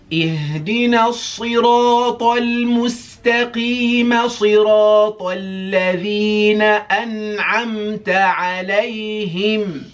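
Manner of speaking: melodic tajweed chant, high and loud; Quran recitation. Shown as a demonstration. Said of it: "so now let's go from ayah six to ayah seven".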